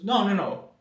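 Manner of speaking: hammed-up pushy fundraiser; mock insistent no. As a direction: cut him off, cheerful but firm. Petitioner insists I must donate.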